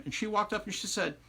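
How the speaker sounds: ordinary delivery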